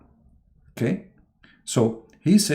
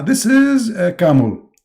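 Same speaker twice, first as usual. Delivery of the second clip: much higher, louder mock Adam; Adam guessing; 8 semitones higher, 7 dB louder than usual. Adam blurting a wrong answer to God in a high mock voice.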